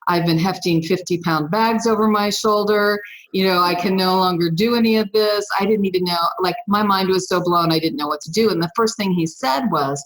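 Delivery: usual speaking voice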